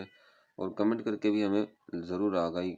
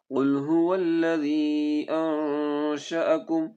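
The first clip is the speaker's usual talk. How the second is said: melodic chanted tajweed recitation; Quran recitation; 7 semitones higher, 7 dB louder, much slower than usual. Shown as a demonstration. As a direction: recite slowly and musically, stretching vowels. Teacher recites Quran verse.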